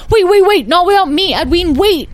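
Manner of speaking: put-on high, loud child's wail; frantic yell. Lyle shouts after his brother Edwin, frantic. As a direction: holler desperately not to be left out.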